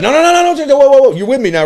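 loud, very high rapid-fire cut-in; urgent interruption. Retold or imitated. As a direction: rein in a heckler, voice shooting up. Reenacting reining in a drunk heckler.

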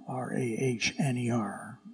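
letters whispered one by one, aside; whispered spelling. Real, not acted. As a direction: breathe out each letter of the name. Spelling out the theologian's name.

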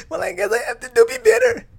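high, loud, fast mock crying; tearful whining. Daughter answering mom's question on the phone, tearful whining. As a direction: squeal it out through hammed-up tears. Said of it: "through the tears".

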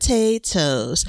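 slow, stretched-out potatoes; hammed-up drawn-out announcement. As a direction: draw out each syllable playfully. Last hammed-up repeat of reveal.

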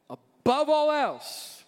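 drawn out high and loud; preacher's big slow call; loud slow exhortation. Acted. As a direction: proclaim it slowly from the pulpit. Sermon climax exhortation.

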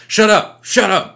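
loud, high snapping shut up; imitating the driver yelling at his co-driver, loud and high; mock angry driver. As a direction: bark it back like a furious driver. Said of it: "the driver is bitching at the dude".

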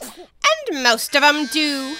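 loud, slow, haughty duchess; imperious plummy grande dame. Acted. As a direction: boom it out like a grand lady. Duchess voice held.

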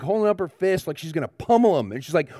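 normal talking voice

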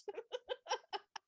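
squealing delighted laugh; a high giggle at the line; shrieking laugh; high burst of laughter. Real, not acted.

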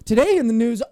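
high, loud mock anchor read; Colin Jost. Co-host mocking a comedy news anchor, high loud announcer imitation. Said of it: "Colin Jost, and he's like".

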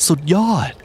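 ordinary delivery

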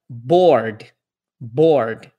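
board with an American R before the D; English R demo. Shown as a demonstration. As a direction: say board, curling the R. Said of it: "next word".

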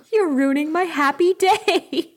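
hammed-up hurt bride, a touch of whine; whiny Buffy. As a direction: pout it out like a spoiled bride. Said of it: "Buffy's like".

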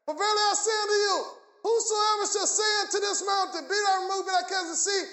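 ringing recitation building to a punched final word; scripture declamation. Real, not acted. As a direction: declaim the verse in one driving run.

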